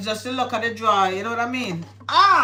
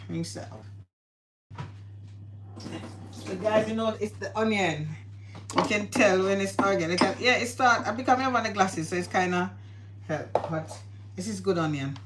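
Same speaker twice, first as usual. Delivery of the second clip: ordinary delivery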